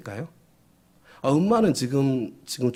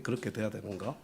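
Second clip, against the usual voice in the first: quiet echo; 3 semitones lower, 12 dB quieter than usual. Shown as a demonstration. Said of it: "좀 그렇게 돼야 되는 거".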